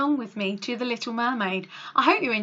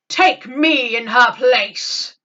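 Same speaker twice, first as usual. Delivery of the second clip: slow, louder kingly offer; a touch of noble king; 8 dB louder, much slower than usual. Acted. King Triton offers himself to Ursula, slow grave kingly voice. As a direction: offer yourself with grave resolve. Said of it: "said the king".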